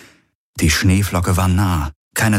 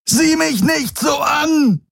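very high, slow, anguished roar; slower than usual. A narrator's reading. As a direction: bellow it at the ghost. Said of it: "brüllte er Vagos Geist an". (gequält gebrüllt)

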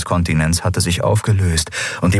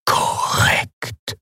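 whispered, slow, faltering machine voice. Acted. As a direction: breathe it out like a failing machine. (geflüsterte Maschinenstimme)

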